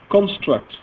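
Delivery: ordinary talk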